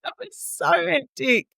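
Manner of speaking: slow, high, laughing through it; dazed laughing whoa. Retold or imitated. New mum looking at everyone after the birth, laughing and high. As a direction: giggle out the whoa, stunned and high.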